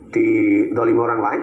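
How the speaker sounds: ordinary talk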